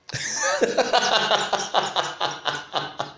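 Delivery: high, soft giggle trailing down; real laughing fit. Real, not acted. Unsure of the word, cracks up in a long high laugh. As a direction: break up laughing at your own mistake.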